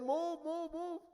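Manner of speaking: high, urgent calls to clear the way; calling out. Acted. The elephant rider shouts at the man blocking the path. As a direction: yell at him to get out of the way.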